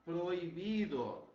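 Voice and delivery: proibido said slowly, loud, no h sound; Spanish prohibido demo; silent h prohibido. Shown as a demonstration. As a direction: stretch out proibido with no h. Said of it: "Olha aqui, ó".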